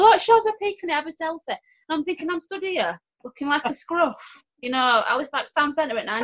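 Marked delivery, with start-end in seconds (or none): 0.0-1.4 1 imitating an excited fan. Fan recognises her in shop.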